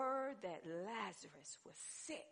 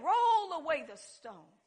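loud, slow command; commanding call; 6 semitones higher, 13 dB louder than usual. Acted. Preacher quoting Jesus at the tomb again, loud command. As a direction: draw the order out forcefully. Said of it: "he said".

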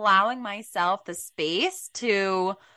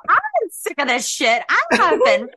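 loud, high, frustrated rant; mock tantrum; 8 semitones higher, 6 dB louder than usual. Telling her friend about her tantrum, loud frustrated rant. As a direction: vent like a fed-up child. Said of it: "I literally had a temper tantrum".

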